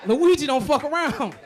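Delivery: normal talking voice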